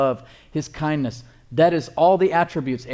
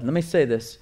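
ordinary talk

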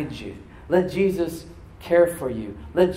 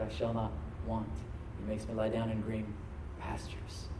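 prayer; 3 semitones lower, 7 dB quieter than usual. Shown as a demonstration. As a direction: pray it quietly, surrendering. Prays softly as example.

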